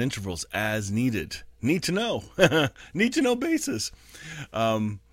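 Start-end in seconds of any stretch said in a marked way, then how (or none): 1.6-3.9 playful laughing repeat; chuckling need-to-know joke; amused. Real, not acted. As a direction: tease it with a laugh. Joking aside about Jesus.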